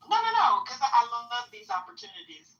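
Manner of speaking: high, loud, emphatic protest; eager refusal. Real, not acted. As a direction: jump in high and loud, relishing the fight. Rejects advice to block her heckler.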